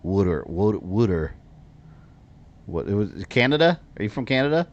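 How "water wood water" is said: "Wooter" with oo as in wood and a d sound; regional wooter on request. Shown as a demonstration. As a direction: repeat "wooter" slowly for them. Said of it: "where are you from with your accent?".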